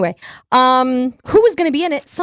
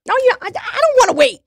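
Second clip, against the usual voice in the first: put-on childish whine; 12 semitones higher, much faster than usual.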